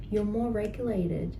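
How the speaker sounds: ordinary talk, nothing marked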